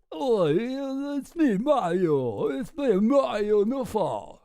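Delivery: high, slow goofy character voice; Mario Nawfal. Pretend. Streamer guessing how the influencer talks, in a slow goofy voice. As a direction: play him as a cartoonish caricature. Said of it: "he probably talks like".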